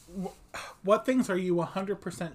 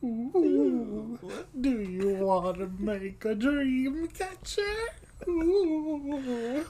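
high airy ghost voice; 6 semitones higher, much slower than usual. Pretend. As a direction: play a crafty ghost, higher. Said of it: "sounds like a ghost".

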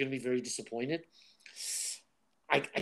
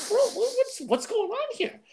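high mock alarm; 5 dB louder than usual. Recalling his worry about Zolf and the auntie, high mock alarm. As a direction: play it as a shocked friend. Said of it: "I was like".

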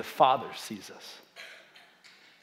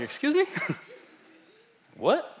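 much higher, incredulous "excuse me"; shocked disbelief; 11 semitones higher, 4 dB quieter than usual. Retold or imitated. Pastor reenacts answering God's voice, stunned and incredulous. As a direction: squeak it out in disbelief with a laugh. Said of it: "And I was like".

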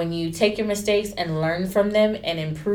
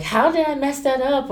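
high-pitched retold self-reproach; dismayed; 8 semitones higher than usual. Stylist recalling going away upset from work, dismayed. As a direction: re-enact asking yourself in dismay.

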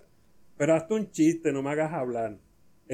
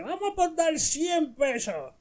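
put-on drunk man. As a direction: play a comic drunk making a bet. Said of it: "Y el otro le dice".

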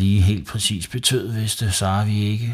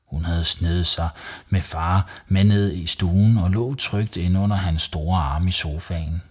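usual speaking voice

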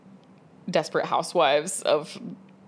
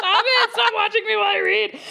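high, louder, laughing plea; laughing protest; 4 dB louder than usual. Retold or imitated. Reader laughing at a nosy flight attendant, high. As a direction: beg her to stop through laughter. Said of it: "I was just like".

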